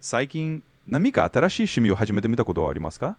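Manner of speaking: fast fluent Japanese question; native Japanese question. Shown as a demonstration. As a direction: clear native Japanese prompt. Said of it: "Can you repeat that, please?".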